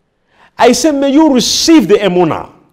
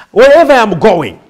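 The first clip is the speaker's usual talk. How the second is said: ordinary delivery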